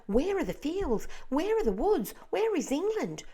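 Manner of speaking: high, loud panicked calls into the void; frightened cry. A narrator's reading. Creatures on the peach crying out in fear. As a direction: cry out lost and terrified. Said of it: "they cried".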